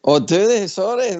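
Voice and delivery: sudden high, loud "oh, do they?"; surprised. Real, not acted. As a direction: caught out and taken aback. Corrected on Belgian languages.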